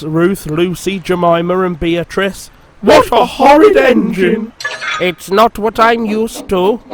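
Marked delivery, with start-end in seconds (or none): 2.8-4.5 much higher, louder indignant cry; insulted coaches crying out together; outraged coaches. A narrator's reading. 5.0-6.8 higher, defiant complaint; haughty engine refusing the coaches; rebellious. A narrator's reading. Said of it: "clanked Sir Handel rebelliously".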